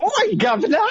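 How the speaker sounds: high-pitched hammy British catchphrase; terrible British accent. Acted. Performer showing off his terrible British accent. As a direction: put on a badly faked British voice, way up high. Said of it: "you doing your terrible, terrible British accent".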